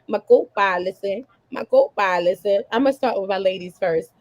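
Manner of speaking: ordinary delivery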